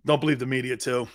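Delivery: ordinary delivery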